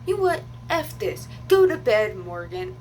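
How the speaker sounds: imitated angry dismissal, high then dropping; retold angry outburst. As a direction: mimic someone snapping in a row. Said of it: "he's like".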